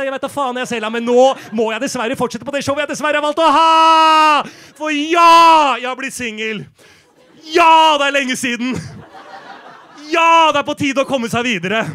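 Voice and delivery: bellowing. Acted. Comedian yelling to Sela that the show must go on. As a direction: blast it out to move the show on. Theatrical shouting to push on.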